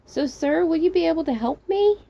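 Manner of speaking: high, polite plea rising at the end; calling for help. Acted. Trapped narrator asking sir for help, calling up. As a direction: call up hopefully to a stranger.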